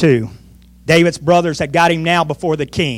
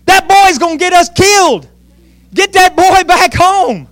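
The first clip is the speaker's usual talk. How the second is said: high, slowing, raised-voice alarm; alarmed soldiers; 9 semitones higher, 3 dB louder than usual. Acted. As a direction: cry out like worried men down the line. Acting alarmed soldiers passing word.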